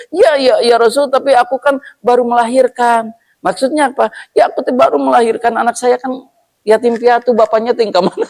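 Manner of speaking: high, pleading voice of a camel with a newborn; pleading camel mother. Acted. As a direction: plead for your calf, high and fast. Camel pleading about its calf. (unta betina memohon dengan suara tinggi)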